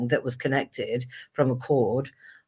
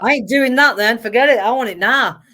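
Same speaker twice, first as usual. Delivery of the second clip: higher and louder stroppy insistence; impatient teenage demand; 9 semitones higher, 8 dB louder than usual. Retold or imitated. Her seventeen-year-old self wanting fame now, loud impatient demand. Teen self rejecting waiting until forty-two.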